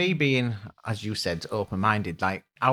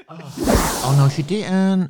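sassy no she didn't; 3 semitones higher, 7 dB louder, much slower than usual. Real, not acted. Friend cheering her playful sassy reply. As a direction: give it full sass.

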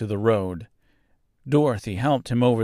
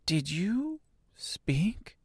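put-on soft, high, slow girl's question; amazed little girl voice; wonder; 11 semitones higher, 5 dB quieter, much slower than usual. A narrator's reading. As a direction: ask slowly in hushed wonder. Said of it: "asked the girl in wonder".

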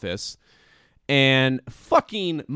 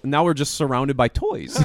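usual speaking voice

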